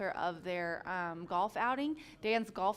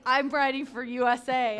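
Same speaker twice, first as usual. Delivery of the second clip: quoting a jockey; 4 semitones higher, 8 dB louder than usual. Retold or imitated. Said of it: "Jack's like".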